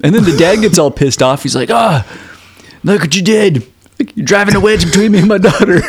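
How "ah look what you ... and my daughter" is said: higher, hammed-up indignant father; mock angry dad. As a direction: whine at him like an outraged dad. Dad gets all pissed off.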